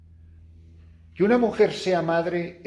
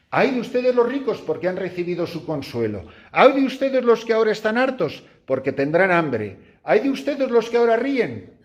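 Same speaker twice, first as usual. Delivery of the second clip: scripture reading; 8 semitones higher than usual. Acted. Quotes gospel.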